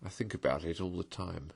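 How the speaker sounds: soft, sad admission; soft wistful longing. A narrator's reading. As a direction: say it quietly, longing for home. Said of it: "pathetically in a lower tone".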